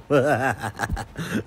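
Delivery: a scornful burst of laughter; short contemptuous laugh; mocking laugh. Real, not acted. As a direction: laugh at the cop's nonsense. Mocking the cop's attempt.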